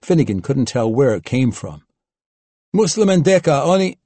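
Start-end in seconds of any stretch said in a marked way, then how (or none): 2.7-4.0 high, louder, a little panicked; a Slavic man's alarmed cry; panicky shout. A narrator's reading. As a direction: call out in panic, cut off abruptly. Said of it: "Slavic and a little panicky".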